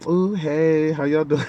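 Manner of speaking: high, put-on goofy voice; lightly hammed-up version of himself; exaggerated greeting. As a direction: overdo your own hello as a joke. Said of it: "Nah, I didn't sound like that".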